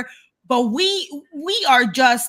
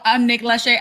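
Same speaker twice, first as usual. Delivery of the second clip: ordinary delivery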